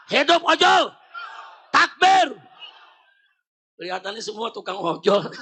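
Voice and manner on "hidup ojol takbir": high, loud slogan call; real rally cry, raised pitch; rallying shout. Real, not acted. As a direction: fire up the crowd.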